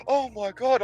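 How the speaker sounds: high, thin imitation of shock; mock horrified gasp. Everyone watching the explosion, high mock horror.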